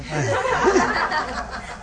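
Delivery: a quick self-mocking chuckle; short real laugh. Real, not acted. Preacher laughing at himself for getting into science. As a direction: laugh it off, light and amused.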